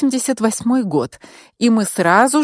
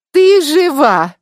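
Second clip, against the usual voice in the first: high, loud, drawn-out cry; exclaiming; 7 semitones higher, 7 dB louder, slower than usual. A narrator's reading. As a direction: exclaim it slowly, pitched up and loud. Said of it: "воскликнула Анна". (громкое, протяжное восклицание)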